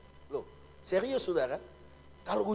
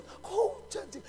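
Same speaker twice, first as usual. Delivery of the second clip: high, slow, whiny mock complaint; 14 semitones higher, 4 dB quieter than usual. Preacher mimics a congregant grumbling about rain, mock whine. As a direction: pout it out like a grumbling kid.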